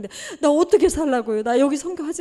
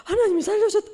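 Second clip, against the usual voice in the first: high, awed cry of '하나님이'; amazed exclamation; 9 semitones higher, faster than usual. Retold or imitated. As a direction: exclaim it as a stunned churchgoer. Voicing the congregation's reaction.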